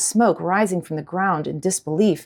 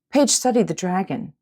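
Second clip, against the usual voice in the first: ordinary delivery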